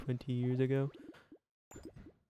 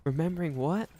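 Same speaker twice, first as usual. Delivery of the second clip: normal talking voice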